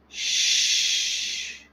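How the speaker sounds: soft whispered shh; gentle whispered hush; loving shush. Shown as a demonstration. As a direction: hush your mind like soothing a baby. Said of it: "to your mind in a loving way, like calming an infant".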